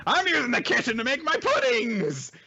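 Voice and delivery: high-pitched, hammed-up insistence; indignant little character. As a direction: squeal it like a territorial little creature. Indignant Naputaku claims the kitchen.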